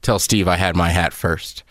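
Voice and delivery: low, offhand delivery; nonchalant. Retold or imitated. Buddy passes a hat claim along for Steve, nonchalant. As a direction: toss it off low and casual. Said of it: "he nonchalant goes".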